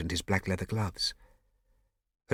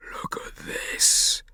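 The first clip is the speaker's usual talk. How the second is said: whispering; 4 dB louder, much slower than usual. Acted. Whispering in the dark house.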